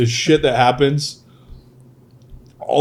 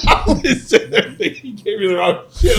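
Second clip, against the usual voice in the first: talking through laughter, pitched way up; laughing; 13 semitones higher than usual. Real, not acted. Salesman recalling his first deal, laughing through it. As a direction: laugh the words out.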